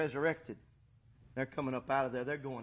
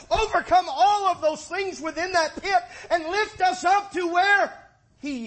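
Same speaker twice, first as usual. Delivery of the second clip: high, loud, rising preacher's climax; preaching at full force; 10 semitones higher, 13 dB louder than usual. Acted. Preacher rousing the congregation about the pit, full-force preaching. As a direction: preach it out to the back pews, louder and higher.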